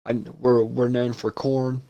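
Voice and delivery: normal talking voice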